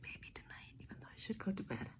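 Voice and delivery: hushed whisper, choked up. Real, not acted. Vlogger whispering to viewers late at night, moved. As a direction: whisper so as not to wake anyone.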